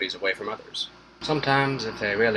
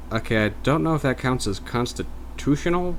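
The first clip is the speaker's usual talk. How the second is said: ordinary talk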